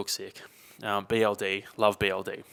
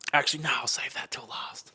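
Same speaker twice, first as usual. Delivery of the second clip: whispered aside to himself; whispering; 3 semitones higher than usual. Real, not acted.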